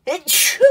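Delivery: a real, sudden sneeze; loud sneeze. Real, not acted. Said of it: "Oh, gonna sneeze".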